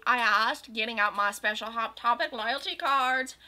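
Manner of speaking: usual speaking voice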